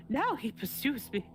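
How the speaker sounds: moaning. Acted. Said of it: "he moaned".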